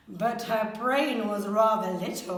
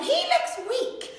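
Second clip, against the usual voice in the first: grumbling mum; 12 semitones higher than usual. A narrator's reading. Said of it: "And she grumbled".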